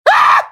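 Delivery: very high shriek of fright; terrified shriek. Acted. [name] shrieks when the clown guest is named. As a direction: shriek at the name.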